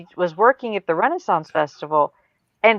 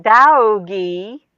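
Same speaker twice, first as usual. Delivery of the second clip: very slow, stretched Southern vowels; Southern drawl; 4 dB louder, much slower than usual. Retold or imitated. Parent imitates their daughter's words, Southern drawl. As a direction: drag each word out like a Southerner. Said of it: "only in the South can we take".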